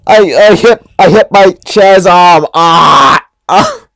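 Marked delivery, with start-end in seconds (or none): 3.5-3.9 high yelp. Acted.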